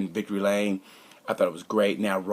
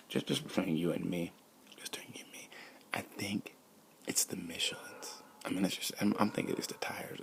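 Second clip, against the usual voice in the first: hushed, much softer aside; confidential whisper; 3 semitones lower, 12 dB quieter than usual. Pretend. Host confides who he blames for the crash, confidential whisper. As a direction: whisper it as if letting someone in on a secret. Confides a secret opinion to listeners.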